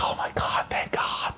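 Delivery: retold relief in a whisper. Donor hearing that he passed, whispered retold relief. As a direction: whisper it, like a secret thanks.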